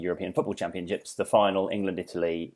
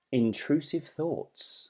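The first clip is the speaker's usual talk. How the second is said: announcer; 4 semitones higher, 6 dB quieter, much slower than usual. Acted. Topic announcement.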